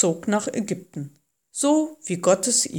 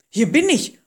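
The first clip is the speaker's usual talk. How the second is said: startled reply, higher and louder; 6 semitones higher, 4 dB louder than usual. A narrator's reading. Moses answering the voice from the fire, startled. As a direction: answer as if caught off guard. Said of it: "antwortete Mose erschrocken".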